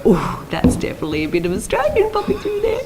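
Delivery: mock wincing reaction. Actress watching her old episodes, mock wincing high voice. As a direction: go high as if catching your own slip.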